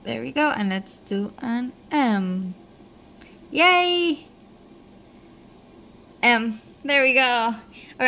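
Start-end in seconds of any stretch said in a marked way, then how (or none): 3.5-4.3 excited cheer. Real, not acted. Celebrating watch setup.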